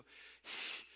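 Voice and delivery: demonstrated Lamaze breaths; exaggerated breathing exercise; imitated breathing-class exhale. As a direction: puff out breaths like a birthing coach. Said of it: "I want you to go".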